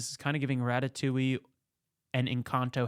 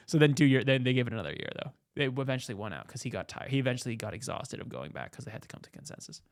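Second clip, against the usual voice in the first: ordinary delivery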